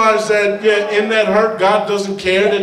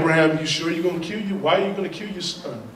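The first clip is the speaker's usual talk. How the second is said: fast, much softer, lower incredulous questioning; imitating doubters; 6 semitones lower, 9 dB quieter, much faster than usual. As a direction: rush it under your breath in disbelief. Voicing doubters questioning Abraham.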